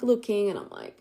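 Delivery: usual speaking voice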